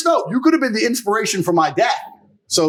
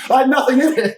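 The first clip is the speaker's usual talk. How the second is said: laughing; 5 semitones higher, 5 dB louder than usual. Real, not acted. Repeats punchline, laughing.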